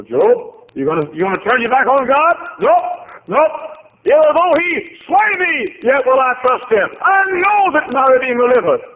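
high, loud preacher's cry; preaching at full force. Acted. Preacher crying out Job's losses to the congregation, full force. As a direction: shout the loss out over the pews.

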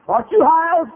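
high, loud mimicked question; excited stranger's call. Fellow spotting the preacher downtown, high and loud. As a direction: pipe up loudly as the stranger. Acting stranger accosting him.

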